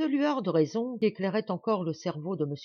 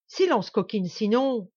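loud, high-pitched silencing order; angry threat; 5 semitones higher, 7 dB louder than usual. Acted. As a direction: cut her short with a threat. Duke silences her with a threat. (menace furieuse, voix forte)

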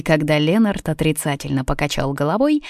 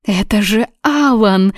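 higher excited exclamation, not whispered; thrilled; 5 semitones higher, 4 dB louder than usual. A narrator's reading. Даниза sees Алан calling on her phone, thrilled. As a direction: announce it with delighted surprise. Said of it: "прошептала она".

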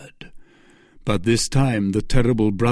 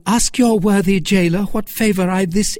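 high, loud mocking retort; mocking Milady; 9 semitones higher, 5 dB louder than usual. A narrator's reading. Milady mocking Lord de Winter, high and scornful. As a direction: taunt the baron.

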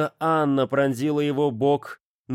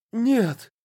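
desperate cry; 12 semitones higher than usual. A narrator's reading. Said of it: "прошептал Андус Фейн".

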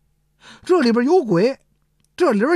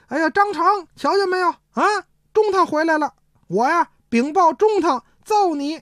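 yelling; 9 semitones higher than usual. Acted. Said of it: "就嚷嚷".

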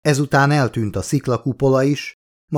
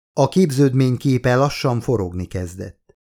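usual speaking voice

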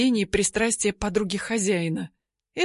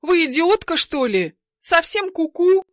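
put-on furious high shout at the girlfriend; indignant yell; 8 semitones higher, 5 dB louder than usual. A narrator's reading. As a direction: hurl it at her in outrage. Maid yells at rude girlfriend.

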